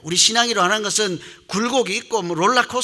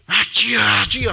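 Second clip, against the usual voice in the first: louder, higher mock cry to the Lord; imitated prayer cry; 4 semitones higher, 7 dB louder, slower than usual. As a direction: call out as if praying fervently. 부르짖는 기도를 흉내. (부르짖는 기도 흉내)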